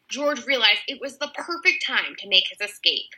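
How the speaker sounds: usual speaking voice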